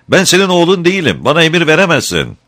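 very high, loud, fast outburst; furious boy. A narrator's reading. Boy defies the woodcutter, shrill fast angry shout. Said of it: "öfkesinden kıpkırmızı kesilerek".

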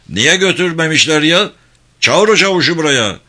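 loud, high, exasperated order; angry command. Acted. The captain angrily orders the sergeant fetched, shouting. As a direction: bark for the sergeant to be brought.

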